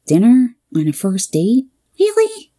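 rising disbelief, "Really?" shooting up high; teasing mock shock; incredulous. Friend Dee reacting to the dinner date, incredulous.

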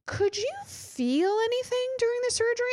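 imitated anxious question, pitch climbing very high; high, tentative mock-nervous voice; nervous dentist. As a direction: tiptoe into the question as the worried dentist. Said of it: "why does this man look terrified for his life".

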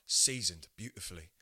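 low, far-off chef voice; low chef pronouncement; Gordon Ramsay impression. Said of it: "He can get away with saying shit like".

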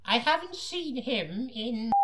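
high, slow falsetto woman; hammed-up high woman voice; young woman voice. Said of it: "just attempt the voice".

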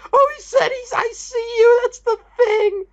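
high, hammed-up blubbering; mock weeping. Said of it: "I wasn't like".

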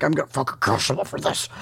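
low, threatening villain voice; gruff menacing growl. Retold or imitated. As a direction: growl it like a thug. Angry Nutcracker threatens revenge.

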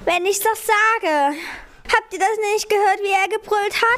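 put-on high, frantic childish insistence; agitated insisting. A narrator's reading. Pupsi insists the others heard the roar, agitated. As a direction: insist urgently, still frightened.

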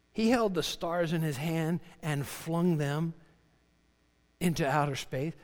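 ordinary talk, nothing marked

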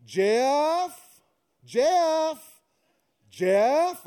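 high, loud repeated call of the name. Retold or imitated. Parrot calling from the house like the wife, high and loud. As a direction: shout it up the stairs three times. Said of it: "I hear the same voice from the house".

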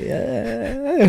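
high, drawn-out gleeful squeal; happy little man. Retold or imitated. Imagining Danny happy on the ride, high mock glee. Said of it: "the little man's all happy".

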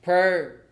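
Prayer said high and loud, falling; emphatic. Acted. Preacher to congregation, stressing Prayer. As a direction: land hard on Prayer.

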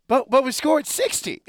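high, loud mock-protest of a fan; excited inner fan. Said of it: "my inner fan".

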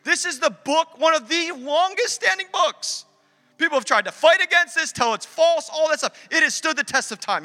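high, forceful pulpit cry; impassioned sermon climax; preaching at full force. Real, not acted. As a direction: drive each phrase home to the congregation.

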